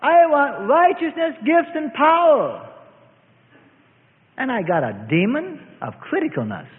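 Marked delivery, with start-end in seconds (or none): 0.0-2.7 high, slow begging prayer to the Lord; mock prayer for power; high pleading prayer. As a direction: beg for gifts in a high, drawn-out plea. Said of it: "but I said".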